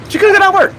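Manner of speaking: high, stressed "couldn't"; high incredulous repeat; emphatic repeat. Real, not acted. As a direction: hammer the disbelief on "couldn't". Repeating for disbelief.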